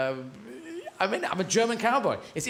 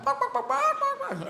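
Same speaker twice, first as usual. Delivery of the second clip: high yappy barks; dog barking. Retold or imitated. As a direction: bark like a hunting dog. Dogs barking in hunt.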